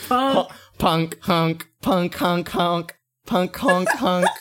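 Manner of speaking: honking like a goose; high nasal goose honk. Pretend. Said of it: "[name] is honking every time".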